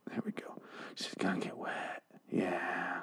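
breathy creepy whisper. Real, not acted. Joking about Sasha getting wet, a creepy whisper. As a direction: hush it down to a whisper. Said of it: "that came out creepy".

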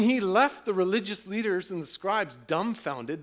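ordinary delivery

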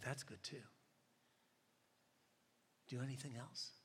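soft, unruffled reply; lightly voiced patient old lady; calm older lady. Calm lady answers the frustrated speaker.

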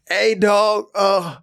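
high, strained, fake-casual voice; coded bro warning. Warning his friend on speaker with his girl listening, a high fake-casual voice. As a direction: signal him hard without saying it.

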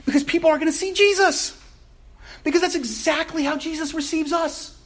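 high, ringing preacher's climax; preaching. Acted. Pastor tells the church people will see Jesus, in full preaching voice. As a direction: lift the room toward Jesus.

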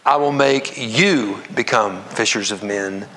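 "you" held long and set apart, slow reading; slow down and single out the word "you"; stress on you. Shown as a demonstration. Preacher rereading the verse aloud with congregation, stress on you. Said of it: "reread it and emphasize the different".

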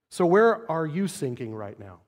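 ordinary talk, nothing marked

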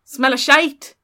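sudden loud, high outburst; mock shout. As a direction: burst out loudly to puncture the hushed drama. Shouted punchline to hushed detective.

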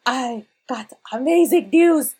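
rushing to the dwarf and blurting out the victory; breathless good news. Acted. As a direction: announce the win, bursting with joy.

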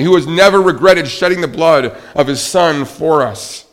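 high, loud sermon climax; fervent pulpit crescendo; impassioned preaching. Real, not acted. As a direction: build a ringing list, punching each phrase.

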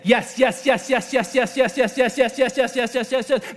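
quick, even machine-gun run of yeses; evenly chanted, relentless string of assent; rapid-fire repetition. Acted. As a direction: reel them off in one steady stream. Acts out God saying endless yeses.

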